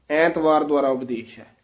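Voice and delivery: ordinary talk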